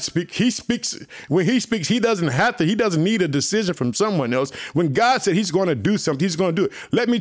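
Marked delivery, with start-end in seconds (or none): none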